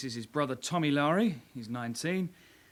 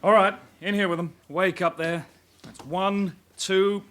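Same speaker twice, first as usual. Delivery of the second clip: loud, higher call across the room; calling out orders; 3 semitones higher, 6 dB louder, slower than usual. Acted. Sergeant barks suspects into the cells, loud. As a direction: herd them in with a raised voice.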